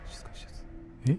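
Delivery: 静かにささやく; whisper. Real, not acted. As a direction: whisper that you're keeping quiet.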